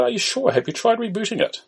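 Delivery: put-on high, questioning Indian accent; Indian-accented help desk query. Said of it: "An Indian voice is heard".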